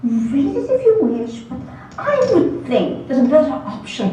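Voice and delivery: fast, lofty pseudo-British lecture; pseudo-British lecturing. Acted. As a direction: rattle it off like a lightly faked British don. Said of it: "in his crisp, pseudo-British accent".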